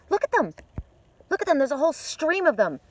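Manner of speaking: high, loud excited pointing out; real excitement, pitched high. Real, not acted. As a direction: urge them to look, thrilled by the birds.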